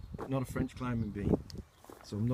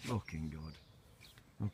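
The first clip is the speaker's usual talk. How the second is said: drawn-out, much softer, lower murmur; slow soft coo; 7 semitones lower, 8 dB quieter, much slower than usual. Real, not acted. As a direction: stretch each word out tenderly to the plant. Cooing again, drawn out.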